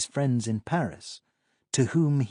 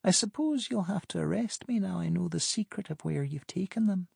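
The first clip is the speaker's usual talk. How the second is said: lightly voiced woman, higher pitch; higher woman's voice; 8 semitones higher, 3 dB quieter than usual. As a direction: play Pamela, amused and higher. Pamela teasing on phone.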